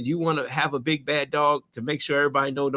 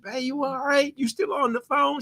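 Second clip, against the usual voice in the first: higher, teasing are-you-there; mock check-in; 8 semitones higher than usual. Landlord teasing a rambling caller, higher voice. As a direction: needle the caller who won't stop.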